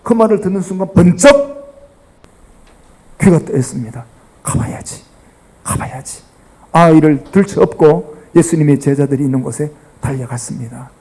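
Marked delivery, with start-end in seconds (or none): 4.4-6.2 breathy whisper to himself; father whispering, I must go; whispered resolve. Acted. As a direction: whisper it like a sudden private decision.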